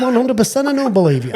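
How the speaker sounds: cracking up mid-line; laughing. Real, not acted. Laughing while retelling.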